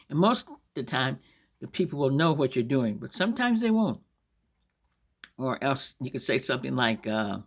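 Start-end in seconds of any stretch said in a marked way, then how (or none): none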